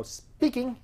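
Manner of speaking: high "Speaking" as an acted pickup; high-pitched phone answer.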